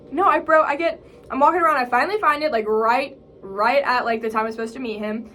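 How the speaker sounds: ordinary delivery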